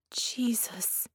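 breathless whispered gasp; stunned, barely a breath; hushed horror; shocked whisper. A narrator's reading. Shocked at seeing Mel.

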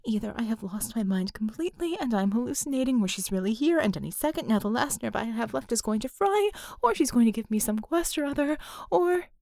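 fast, rising panicked thinking; panicked rush. Acted. Panicked inner thoughts.

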